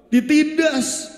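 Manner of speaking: ditindas drawn out slowly, pitched high; heavy, slow sermon lament; slow, lamenting preaching. Acted. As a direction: dwell on every word of the suffering. Preacher describes Israel's oppression.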